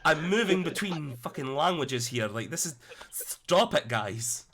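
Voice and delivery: loud, high-pitched flustered outburst; exasperated protest. Real, not acted. Game master flustered by slipping accents, loud exasperation. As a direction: protest loudly over the teasing.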